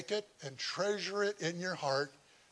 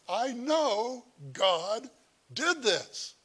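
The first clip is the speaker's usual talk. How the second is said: awed declaration; 6 semitones higher, 3 dB louder, much slower than usual. Retold or imitated. Paul's awed claim retold.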